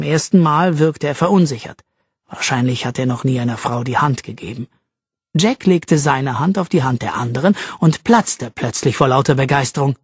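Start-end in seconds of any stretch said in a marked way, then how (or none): none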